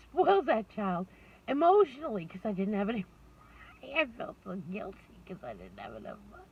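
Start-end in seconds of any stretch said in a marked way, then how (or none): none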